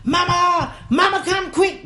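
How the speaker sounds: yelling for mama. Retold or imitated. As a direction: shout for mama in panic. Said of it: "I went yelling for my mama".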